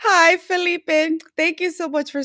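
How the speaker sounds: beam out a welcome to Felipe; excited greeting. Real, not acted.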